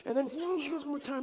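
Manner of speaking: normal talking voice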